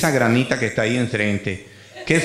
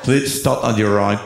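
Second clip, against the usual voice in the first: slow, lower American cop voice; American loudspeaker English; 6 semitones lower, slower than usual. Retold or imitated. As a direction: drag it out like a police megaphone. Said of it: "el inglés que tú estudiaste no se parece".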